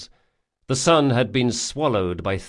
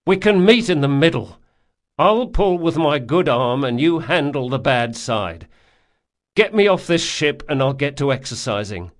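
louder, higher, insistent; wounded man bargaining; 5 semitones higher, 5 dB louder than usual. Acted. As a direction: push the deal firmly. Locke bargains.

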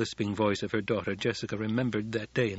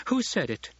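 high, insistent demand; 7 semitones higher than usual. A narrator's reading. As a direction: demand an answer. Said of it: "Hara repeated".

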